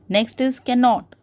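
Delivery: ordinary talk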